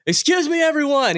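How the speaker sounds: high-pitched announcing yell; hollered mock public announcement; Will Ferrell yelling. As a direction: imitate calling a whole crowd to attention. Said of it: "He, like, yells".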